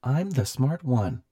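ringing, high techno voice; chiming machine voice. A narrator's reading. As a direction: chime it out boldly as a sinister gadget. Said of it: "the voice chimed".

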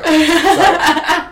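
laughing. Real, not acted. Laughing apology.

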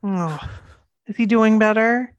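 usual speaking voice

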